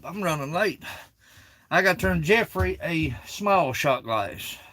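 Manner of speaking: ordinary delivery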